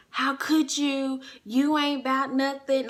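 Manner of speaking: high, loud retold accusation; mock the boyfriend's loud, indignant scolding; cussing someone out. Boyfriend going off on her in the hotel room, cussing her out. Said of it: "just cussing me out".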